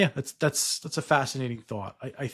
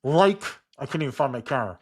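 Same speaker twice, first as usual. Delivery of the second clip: muffled by mask; 6 dB louder than usual. Real, not acted. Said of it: "I got a latex mask on my face".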